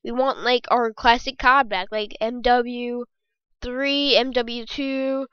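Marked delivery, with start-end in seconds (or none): none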